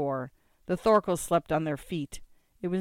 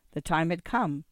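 plain reading voice

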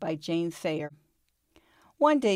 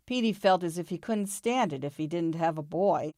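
usual speaking voice

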